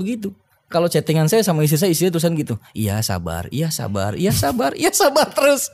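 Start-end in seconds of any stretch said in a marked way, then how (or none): 2.7-4.1 low, soft and steady; calm reassurance. Retold or imitated. Husband calming his worried wife, low and soft. 4.1-5.7 higher, exasperated wife voice; mock her fed-up complaint; complaining wife. The wife tired of being told to wait, exasperated.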